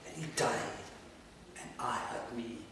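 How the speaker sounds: no voice, a broken whisper; hushed whisper. Acted. After he died, whispering that she was alone. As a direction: barely let it out.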